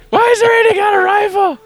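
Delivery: very high-pitched panicked squeal; mock panic. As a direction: squeal like a scared audience member, hammed up. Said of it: "Now people are scared".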